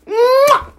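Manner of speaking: high-pitched mouth-made smack sound; imitated slap. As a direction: mimic the slap with one sudden high "mwah". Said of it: "I smacked the".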